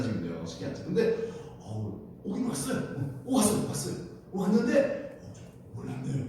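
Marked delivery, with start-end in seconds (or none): 2.2-6.2 high feigned candour, dropping on the denial; playing innocent. Rahab lying to the detectives, playing innocent. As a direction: put on an act of knowing nothing. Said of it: "연기하는 거예요".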